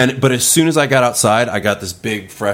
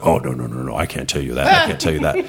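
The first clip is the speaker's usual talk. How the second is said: lower, playful dodge of the question; coy joking; 7 semitones lower than usual. Retold or imitated. John joking to Seth about his job, low coy tease. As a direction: tease him that it's a secret, voice dropped. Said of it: "he would kinda joke".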